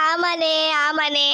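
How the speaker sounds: slow, low staged sobbing; put-on crying. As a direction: confirm it through sham sobs. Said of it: "Lakshmi எதுக்கு அழுதுட்டு இருக்கா". (போலியாக விம்மி அழுதபடி)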